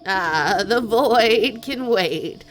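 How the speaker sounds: loud, slow goat voice. Acted. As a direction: boom it out as the goat. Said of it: "I'm glad you like the goat voice".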